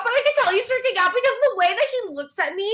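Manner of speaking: normal talking voice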